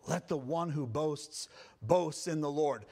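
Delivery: ordinary talk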